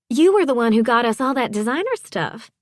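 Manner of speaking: high, excited Southern character voice; impressed Southern wife. A narrator's reading. Wife recognising the stylist behind her designer clothes, impressed. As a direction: gush high-voiced in amazement.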